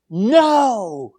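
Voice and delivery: loud, high, forceful refusal; emphatic no. Acted. Preacher voicing Peter's emphatic denial to Jesus. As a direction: bark it out like a firm denial. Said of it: "I had to say that like that 'cause he said it emphatically".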